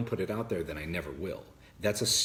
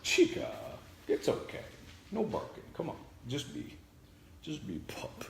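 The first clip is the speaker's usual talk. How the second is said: talking to the dog; 9 semitones higher than usual. Real, not acted. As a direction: hush the puppy gently. Hushing the dog.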